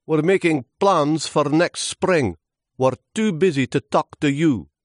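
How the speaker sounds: high, loud little twig voice; busy, dismissive twigs. Acted. Twigs too busy to talk to Lewis, high and dismissive. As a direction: brush him off in a high, loud voice.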